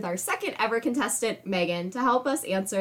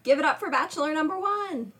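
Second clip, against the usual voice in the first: booming pumped announcer; 8 semitones higher than usual. Acted. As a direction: hype the bachelor like a TV host. Game show host intro.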